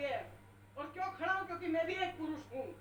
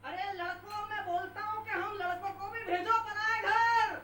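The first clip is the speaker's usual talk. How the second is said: much louder punchline call; rallying shout; 8 dB louder than usual. Real, not acted. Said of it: "rallying the boys".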